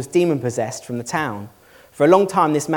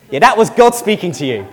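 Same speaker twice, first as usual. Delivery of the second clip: loud, higher, breaking into a real laugh; amused; 4 semitones higher, 7 dB louder than usual. Real, not acted. Preacher to congregation about good thoughts, amused and laughing. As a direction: deliver the punchline tipping over into laughter.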